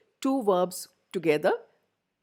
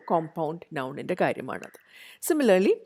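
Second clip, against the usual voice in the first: ordinary talk, nothing marked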